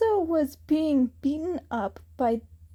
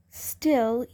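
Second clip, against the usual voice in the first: ordinary delivery, nothing marked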